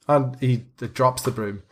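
ordinary talk

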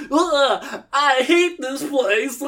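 high, slow whiny groan; whiny complaint. Acted. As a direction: whine it like a sulky coward. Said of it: "and Weenie goes".

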